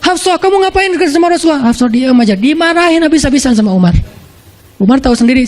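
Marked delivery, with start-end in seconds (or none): 0.0-1.7 higher, scolding tone; scolding father. Retold or imitated. Umar scolding his daughter Hafsah, higher scolding voice. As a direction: tell off your daughter sharply. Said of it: "Langsung Umar marah-marah".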